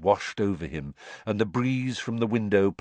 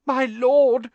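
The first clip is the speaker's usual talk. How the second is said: high, loud, gasped plea; gasping servant; 8 dB louder than usual. A narrator's reading. Man on the floor to his master, gasping. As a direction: gasp it out like a cowering servant. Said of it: "gasped the man on the floor".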